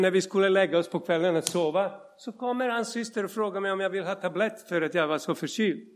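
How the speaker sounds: usual speaking voice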